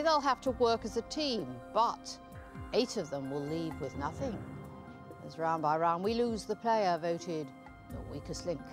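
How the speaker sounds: normal talking voice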